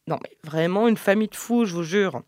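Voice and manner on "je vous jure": usual speaking voice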